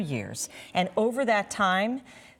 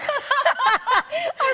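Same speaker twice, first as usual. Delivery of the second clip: sudden loud laugh, much higher; a real laugh bursting out in surprise; burst of laughter; 7 dB louder than usual. Real, not acted. Fitbit buzzes mid-sentence.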